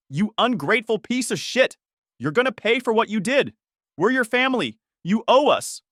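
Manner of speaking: high-pitched, angry acted shouting; shouted threats. A narrator's reading. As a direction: hurl the threats in outrage, pitch well up. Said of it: "taking turns shouting threats".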